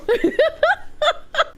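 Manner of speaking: high-pitched real laughing fit; helpless high giggling. Real, not acted. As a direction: crack up at your own joke. Laughing at own joke.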